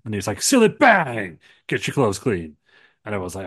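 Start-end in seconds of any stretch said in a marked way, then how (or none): 0.4-2.6 high, loud opening, then easing off; audition slogan read. Acted. Retelling the wrestler's advert audition, booming pitchman. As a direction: shout the brand, then deliver the tagline. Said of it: "he did the audition".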